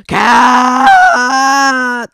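sudden shrieking yell; piercing startled cry; screaming outburst. Real, not acted.

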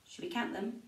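ordinary talk, nothing marked

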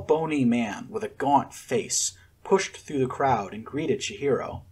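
plain reading voice

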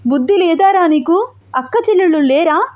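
high-pitched scolding after tears; indignant questions, lightly acted; tearful reproach. A narrator's reading. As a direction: scold him while wiping away tears. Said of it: "కన్నీళ్లు తుడుచుకుంటూ అంది".

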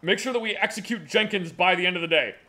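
low, gruff boss voice; goofy muffled sock puppet. Acted. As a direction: play a pompous low-voiced official. Plays the official in the skit.

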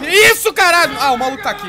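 startled panicked scream; being chased. Real, not acted. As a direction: yell in sudden fright. Monster appears, player screams.

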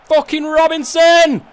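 loud, high, drawn-out furious yell; angry shout. Real, not acted. Player yelling at his striker for missing, furious shout. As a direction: roar at the striker in disbelief.

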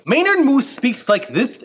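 a hint of a folksy moose narrator; Maynard Moose voice. Shown as a demonstration. Librarian demos to students how Maynard Moose talks. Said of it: "Maynard Moose talks in a different way".

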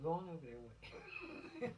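a hint of a bored officer brushing off a kid; dismissive officer. Officer telling a teen asking for mom to go wait, lightly mocking. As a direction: wave the kid off, quietly. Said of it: "That's all they say".

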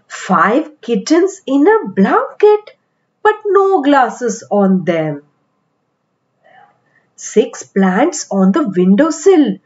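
plain reading voice

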